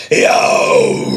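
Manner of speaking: thin, breathy half-hearted yo; weak scream. Shown as a demonstration. Showing viewers the weak scream, a thin breathy yo. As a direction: scream it timidly. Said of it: "That sounds a little bit weak".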